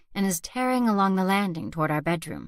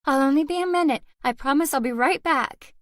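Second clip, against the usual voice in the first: hurried promise; 7 semitones higher, 4 dB louder than usual. A narrator's reading. Mother rushing promise to child.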